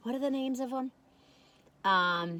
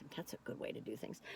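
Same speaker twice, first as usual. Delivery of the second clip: whispered, much softer afterthought; whispered aside; 11 semitones lower, 17 dB quieter than usual. Real, not acted. Approving her own plan, whispered aside. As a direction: drop to a whisper for yourself.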